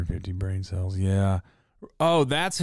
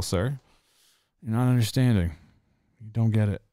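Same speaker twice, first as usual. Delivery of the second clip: usual speaking voice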